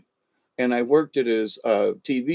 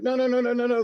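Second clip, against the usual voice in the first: high, rattled-off string of denials; mock protest; 11 semitones higher, much faster than usual. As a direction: imitate a panicked protest, pitched way up. Retells protesting his near arrest.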